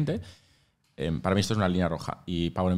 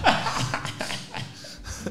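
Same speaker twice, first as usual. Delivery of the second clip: silent wheeze laugh. Real, not acted. Laughter after the chaos.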